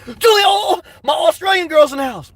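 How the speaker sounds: excited shout to the fans. Real, not acted. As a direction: hype up the Australian fans. Excited shout-out to Australian viewers.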